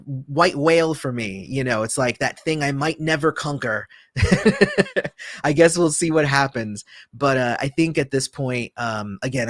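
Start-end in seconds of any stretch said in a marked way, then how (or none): 4.2-5.4 short amused laugh; a quick rueful chuckle. Real, not acted. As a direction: laugh at your own long quest.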